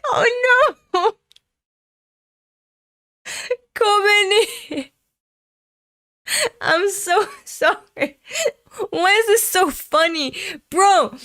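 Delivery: high, loud, laughing wail; helpless laughter. Real, not acted. Reader apologizing to Kobeni for finding it funny, helpless laughter. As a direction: cry out through uncontrollable laughter. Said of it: "we're laughing now".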